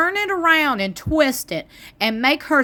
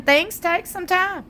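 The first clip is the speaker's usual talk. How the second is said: ordinary talk, nothing marked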